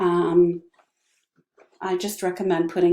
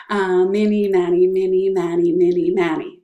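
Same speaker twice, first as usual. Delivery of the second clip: "mini Manny" louder, crisp and repeated; a louder, punchy run of "mini Manny"; loud mini Manny drill; 7 dB louder than usual. Shown as a demonstration. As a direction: belt out "mini Manny" as a tongue twister. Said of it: "It's really fun if you say them all super fast".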